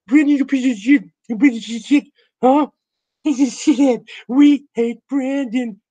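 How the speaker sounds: high, squeaky mocking taunt. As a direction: taunt Brandon in a silly squeaky voice. Mocking Brandon in a voice.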